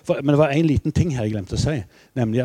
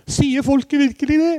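put-on high, surprised old-king voice; King Olav; 14 semitones higher, 4 dB louder than usual. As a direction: imitate the king's delighted disbelief. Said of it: "sa, sa kongen". (etterligner kong Olav, lys og overrasket)

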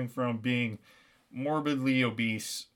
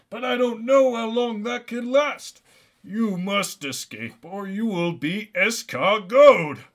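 raised, forceful dramatic plea; hammed-up immortal captive urging escape; urgent Santa warning; 6 semitones higher, 6 dB louder than usual.

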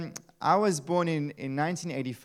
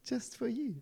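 tender; 6 semitones higher, 10 dB quieter than usual. Retold or imitated. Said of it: "he says".

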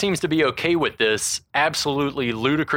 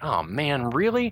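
put-on twelve-year-old self; 4 semitones higher, slower than usual. Host as his twelve-year-old self seeing the actors, dismayed kid voice. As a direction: voice rising high on "really". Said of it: "twelve-year-old me was like".

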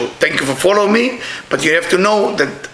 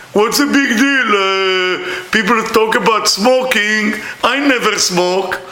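a touch of smug, higher voice; smug person; 4 semitones higher, slower than usual. Retold or imitated. Teacher mocking people who never smoked, lightly smug. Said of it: "those people who talk like this".

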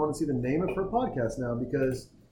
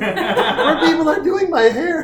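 laughing; 13 semitones higher, 9 dB louder than usual. Pretend. Mocking imaginary world, laughing.

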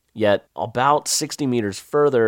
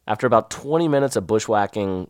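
ordinary delivery

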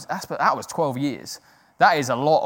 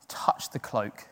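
soft unvoiced whisper; 6 semitones lower, 9 dB quieter, slower than usual. Real, not acted. Preacher lets the congregation hear a hushed whisper.

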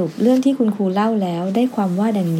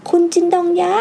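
put-on high-pitched child's call, moderately raised; 8 semitones higher than usual. A narrator's reading. Girl at classroom window hails street musicians, calling out high. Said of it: "โตโต้จังก็ตะโกนขึ้นว่า".

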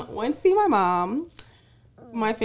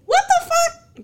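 very high, loud, drawn-out disbelief; stunned squeal of appreciation; astonished outburst; 6 dB louder, slower than usual. Acted. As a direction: exclaim it slowly in high-pitched amazement. Astonished at mother's kind gesture.

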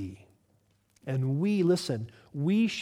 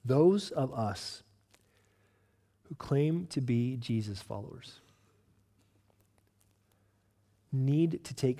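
soft earnest appeal; 5 semitones lower, 8 dB quieter than usual. Real, not acted. Pastor quietly urging Jesus followers, soft and low. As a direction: lean in and speak it gently.

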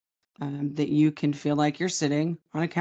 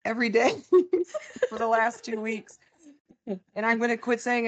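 laughing; 8 semitones higher than usual. Real, not acted. Laughing mid-complaint.

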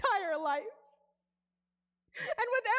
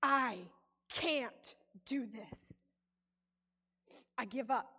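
defeated surrender; 9 semitones lower, 5 dB quieter, slower than usual. Retold or imitated. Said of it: "And I said".

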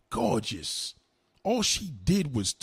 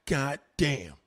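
ordinary talk, nothing marked

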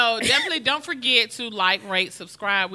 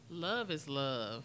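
sincere; 5 semitones lower, 12 dB quieter, much slower than usual. Real, not acted. Closing message, slow and soft.

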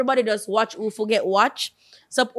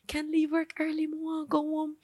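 soft pleading quote; 3 semitones higher, 10 dB quieter than usual. Retold or imitated. Quoting herself calling Jeff.

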